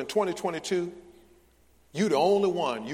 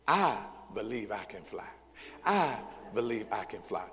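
low, "I" held out, then a pause; mock congregational recitation stressing "I"; 5 semitones lower than usual. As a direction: lean on the first "I" of "I believe". Mock congregational chant, first word stressed.

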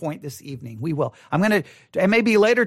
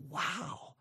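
very soft, awed exclamation; acted quiet amazement; hushed awe; 3 semitones lower, 19 dB quieter than usual. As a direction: let it out barely voiced, in wonder. Imagined awed reaction to the conclusion.

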